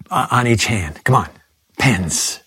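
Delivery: ordinary delivery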